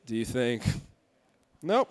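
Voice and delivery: ordinary talk, nothing marked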